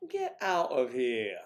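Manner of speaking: 1 mock shock.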